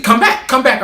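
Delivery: high, loud imitated call; mock calling after. Teacher calling after the storming-out student, mock pleading. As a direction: mimic her calling him back.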